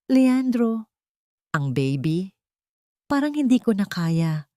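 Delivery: a lightly acted plea through labor pain; a touch of a woman in labor begging her husband; strained, hurting plea; faint pained moan. A narrator's reading. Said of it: "Ang mahinang daing ni Tala".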